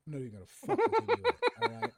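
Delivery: cracking up while refusing; laughing. Real, not acted. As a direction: blurt it out through a laugh. Laughing off the Inuit idea.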